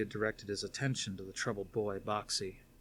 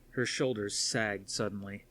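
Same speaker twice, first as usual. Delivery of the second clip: usual speaking voice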